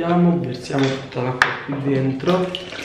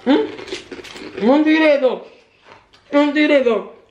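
surprised; 12 semitones higher, 3 dB louder, much slower than usual. Real, not acted. Reacting to fortune cookie.

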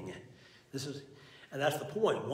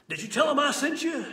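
much higher and louder suspicious mom; mom's voice; 12 semitones higher, 8 dB louder than usual. Retold or imitated. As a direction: play the fed-up mother checking up.

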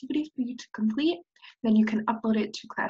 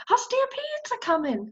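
high, urgent alarm; urgent hen; 13 semitones higher than usual. Acted. Hen warns the jackrabbit of stampede. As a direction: blurt the warning. Mary voiced.

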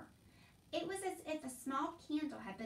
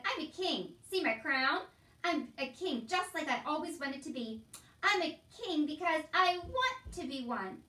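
loud, raised jeering boast; mock-king boasting; 5 semitones higher, 8 dB louder than usual. A narrator's reading. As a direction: crow like a clownish king. Uncle clowns as a mock king.

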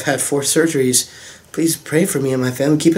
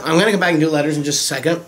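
ordinary talk

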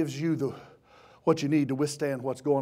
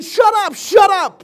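high, loud acted yell; preacher playing an angry crowd; crowd shouting down; 17 dB louder, slower than usual. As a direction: silence someone with a full shout. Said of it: "they cried out louder and louder".

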